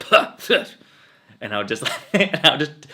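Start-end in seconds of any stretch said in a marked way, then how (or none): 0.1-0.6 high, loud huffing noises; a sputtering, fed-up huff; mock exasperated puffing. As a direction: puff out in mock frustration. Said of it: "And I'd be like".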